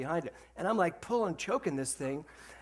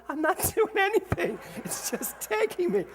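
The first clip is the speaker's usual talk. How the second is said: whiny protest; 13 semitones higher, 4 dB louder than usual. Retold or imitated. Rider protesting to yelling people, high whiny plea. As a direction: plead innocence in a high whine.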